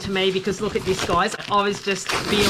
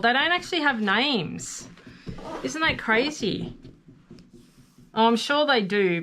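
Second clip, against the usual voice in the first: ordinary delivery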